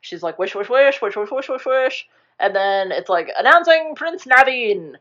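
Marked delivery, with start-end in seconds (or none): 0.4-2.1 higher, rapid-fire repeated wishes; mock frantic wishing. Retelling Charlotte wishing on the star, mock frantic wishing. 3.4-5.0 high, ringing announcement; mock herald. A herald announcing the prince at the ball, mock herald.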